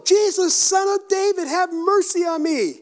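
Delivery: high, loud pleading shout; yelling. Acted. Blind beggar begging Jesus for mercy, yelling. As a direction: cry out for mercy at full voice. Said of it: "So he's, he's yelling".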